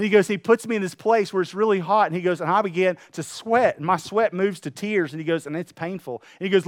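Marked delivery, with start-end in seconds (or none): none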